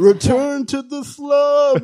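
half-hearted squeaky whine; mock guest voice. Mocking the guest's voice.

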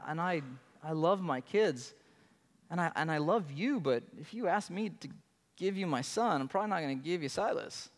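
normal talking voice

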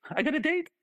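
very high, delighted announcement; proud little squeal of news; thrilled. Retold or imitated. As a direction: client telling her coach about the date, thrilled. Client reports her success.

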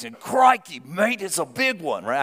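hammed-up Australian croc-hunter exclamation; Steve Irwin. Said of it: "I'm terrible at accents".

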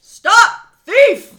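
loud, high call after a thief; shouting. Acted. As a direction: yell across the street to stop him. Character calls out after thief.

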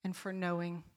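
soft, trembling prayer; hushed prayer. Retold or imitated. Woman praying to God in her car, hushed. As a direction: pray it under your breath.

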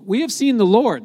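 high, loud, elated proclamation; excited announcement. Acted. Disciples telling Thomas after the resurrection, excited. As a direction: break the big news with a burst of excitement.

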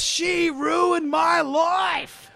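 very slow, high wailing shout; hammed-up Australian tantrum; screaming Australian kid. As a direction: wail it like a furious Aussie boy. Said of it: "my favorite part was".

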